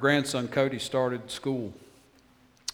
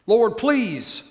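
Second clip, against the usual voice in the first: loud, high, urgent questions to the Lord; desperate, raised appeals; pleading prayer; 7 semitones higher, 7 dB louder than usual. Acted. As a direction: beg God again and again, pressing hard. Moses pleading with God.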